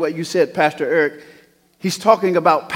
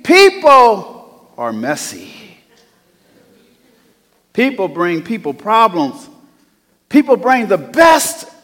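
rising, louder preacher's cadence; full-force preaching; 8 semitones higher, 8 dB louder than usual. Acted. Pastor preaching to the congregation, full force.

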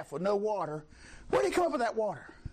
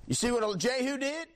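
ordinary talk

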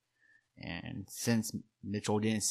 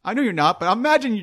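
ordinary talk, nothing marked

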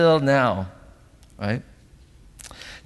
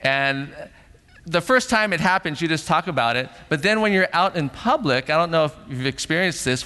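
ordinary delivery

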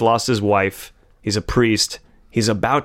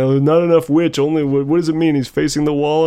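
higher, louder mock whine; mocking whiny complainer; 5 semitones higher, 3 dB louder than usual. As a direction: whine it like a fed-up viewer. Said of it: "people complain".

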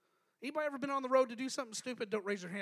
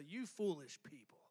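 lower, softer, cold scolding; harsh rebuke; 6 semitones lower, 5 dB quieter than usual. Retold or imitated. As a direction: say it as a cutting put-down. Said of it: "I don't think he said it that way".

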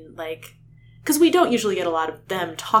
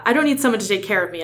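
quick, defensive retort, a bit louder; bristling; 3 semitones higher, 6 dB louder, faster than usual. Acted. Hawk boy answering Rachel in a dramatic reading, bristling. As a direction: bristle at being looked after. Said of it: "I bristled".

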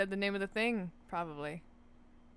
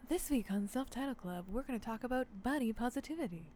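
therapist voice; 7 dB quieter than usual. Pretend. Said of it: "In my best therapist voice".

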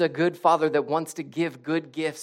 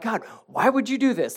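higher, exasperated mock protest; angry complaint; 6 semitones higher, 4 dB louder than usual. Preacher acting out himself complaining to God, mock angry. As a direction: act out griping up at heaven. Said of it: "then I get angry".

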